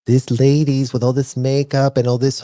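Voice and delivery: usual speaking voice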